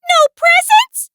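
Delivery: very high, loud mouse squeak; little mouse squealing in disbelief; dismayed mouse. A narrator's reading. Mouse learns there are no presents, loud dismayed squeak.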